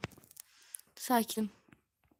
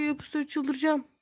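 ordinary delivery

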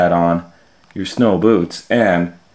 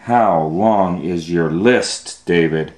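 usual speaking voice

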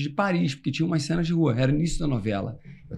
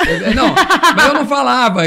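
laughing; 7 semitones higher, 9 dB louder, faster than usual. Real, not acted. Laughing admitting he didn't speak.

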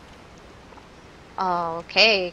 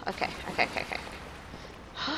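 breathy whispered self-reassurance; panicking; 12 dB quieter than usual. Real, not acted. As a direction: whisper repeatedly, trying to hold it together. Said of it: "I'm panicking".

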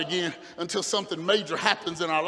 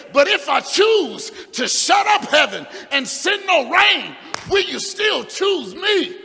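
God's question shouted higher and louder; preaching shout; 7 semitones higher, 5 dB louder, slower than usual. Acted. Preacher as God asking Solomon, shouted. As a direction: demand an answer, slowing on the end.

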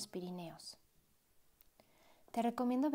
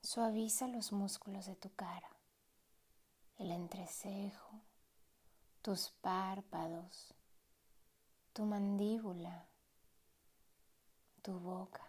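unhurried meditation voice with long pauses; guided meditation. Acted. Meditation guide easing a listener into relaxed breathing, guided meditation voice. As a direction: guide the listener gently, letting each phrase settle.

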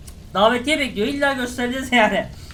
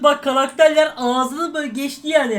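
ordinary talk, nothing marked

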